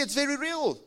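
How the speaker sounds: high, loud preaching peak; fiery preaching. Acted. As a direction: preach it at full force.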